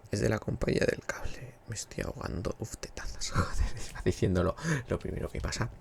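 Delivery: hushed whisper so he isn't heard. Real, not acted. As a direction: whisper it, keep it down. Cable company man is nearby.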